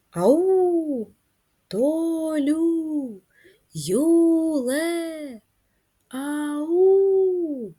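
high drawn-out calls into the woods; calling out. Shown as a demonstration. Teacher shows kids calling for lost friends. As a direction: stretch each call to be heard far. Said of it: "протягуємо ці слова".

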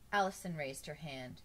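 ordinary delivery, nothing marked

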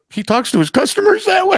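very high, incredulous mock question; shocked callers. Imitating wife's colleagues scandalized by husband's language, high mock shock. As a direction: shoot the pitch way up in feigned disbelief. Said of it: "And they're like".

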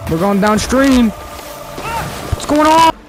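high-pitched, rising alarm; alarmed outcry. Real, not acted. Boat carried downstream, second take.

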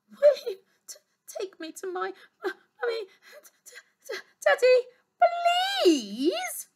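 put-on choked-up, stammering little girl's voice, breaking into sobs; tearful shivering plea. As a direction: beg through tears, stuttering on daddy. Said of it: "shivered the little princess".

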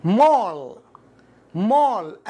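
"mall" with a long rounded "aw". Shown as a demonstration. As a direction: model "mall" deliberately, vowel rounded. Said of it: "ال A مضموم بسبب". (نطق "mall" بضم الـA)

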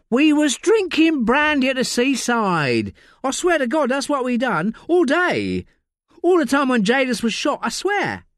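high, coarse London voice of a desperate addict; Layla, rough addict. Acted. Addict Layla snapping at detective Josie in interview. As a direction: snap like a strung-out woman in custody.

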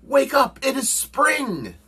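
very high, loud wake-up call; shouting. A narrator's reading. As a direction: holler it high to rouse a sleeper. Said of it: "shouted Frog".

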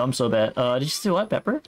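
ordinary talk

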